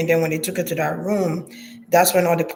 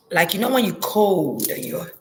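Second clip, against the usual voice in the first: usual speaking voice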